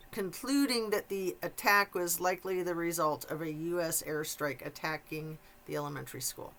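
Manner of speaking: ordinary delivery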